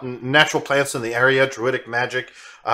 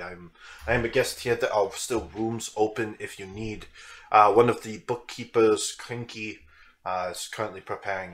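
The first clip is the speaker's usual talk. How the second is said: lower, slow, measured voice of a polite guest; calm formal gentleman; 5 semitones lower, 5 dB quieter than usual. Acted. Valjean explaining the cat to the party, lower and unhurried. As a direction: speak like a composed scholar making excuses for the cat.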